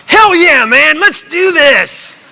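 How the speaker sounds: very high, loud, slow hyped bro voice; loud high cheering doctor joking; mock pumped-up doctor. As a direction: ham it up like an overjoyed party bro. Mock doctor endorsing raw diet.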